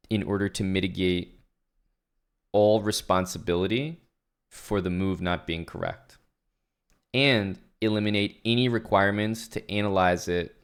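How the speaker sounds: ordinary talk, nothing marked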